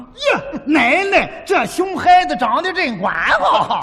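loud, high, forceful Xuzhou accent; hard Xuzhou dialect. Shown as a demonstration. Comic showing partner Xuzhou praise with vigour, hard Xuzhou dialect. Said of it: "是硬了咯叽就说出来了".

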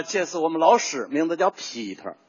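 Tianjin-style Peter with the tone falling; Tianjin accent. Shown as a demonstration. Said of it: "你看他往下去".

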